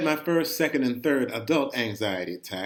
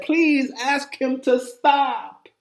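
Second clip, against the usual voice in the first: much higher, slow, pleading; desperate plea; 13 semitones higher, 7 dB louder, much slower than usual. Retold or imitated. Boy begging his dad on the ride, slow desperate plea. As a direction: beg him, drawn out and scared. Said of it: "I tell my dad".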